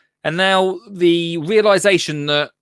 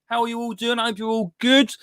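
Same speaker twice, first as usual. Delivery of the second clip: cheery host greeting; 8 semitones higher than usual. Acted. Presenter opening the Monday garden show with a cheery welcome. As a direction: welcome the viewers warmly to the show.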